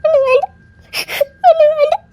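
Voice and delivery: hurt wife refusing her husband through tears; crying refusal. Acted. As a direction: sob out the refusal. Wife crying, refusing his help.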